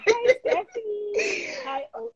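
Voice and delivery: slow, high, delighted hello; excited greeting. Real, not acted. Greeting viewers on live.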